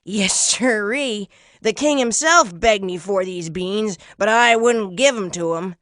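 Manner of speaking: put-on loud, boastful old-timer; old trader talking up his wares; strange old man. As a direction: brag like a folksy huckster.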